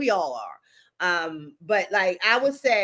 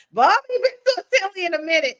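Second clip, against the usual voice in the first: quoted through laughter, a little louder; retelling Bob's remark while laughing; laughing quote; 6 semitones higher, 5 dB louder than usual. Retold or imitated. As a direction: laugh while repeating his words. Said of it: "Bob was like".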